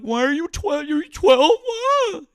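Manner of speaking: very high, loud hammed-up panic; mocking imitation. Host imitating caller crashing out, mocking. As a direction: parody his outrage in a shrieky high voice. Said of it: "You're like".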